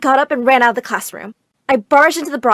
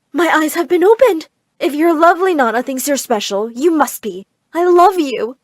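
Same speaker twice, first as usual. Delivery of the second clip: doting mother; 5 semitones higher, 5 dB louder than usual. Acted. Mother suddenly adoring her daughter.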